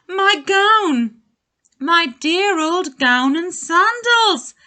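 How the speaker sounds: delighted high loud exclamation; joyful cry. A narrator's reading. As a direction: cry out overjoyed at finding it. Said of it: "he cried".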